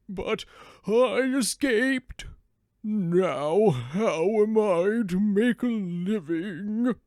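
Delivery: a worn-out hound pouring out his sorrows slowly; old dog voice. Acted. Old dog tells the donkey his master meant to kill him, slow and woeful.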